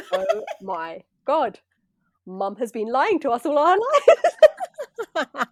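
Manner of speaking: slow, loud, laughing outrage; laughing disbelief. Retold or imitated. Retelling her realisation to her sister, laughing. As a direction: laugh through the shock of it. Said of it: "And I was like".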